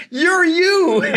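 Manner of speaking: very high, loud incredulous cry; mock exasperation. Retelling colleague's answer to the bewildered guest, mock exasperated. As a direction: hammer the punchline high in disbelief.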